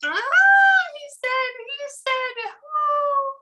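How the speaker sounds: very high, thin squealed reaction; high-pitched squeal. Real, not acted. Medium reacting to a dirty joke, high-pitched squeal. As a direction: squeal it out, far above your normal voice.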